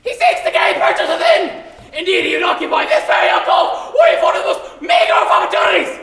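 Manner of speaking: very high, loud frantic yell; panicked shout. Acted. Pig cries out to pig three about the wolf.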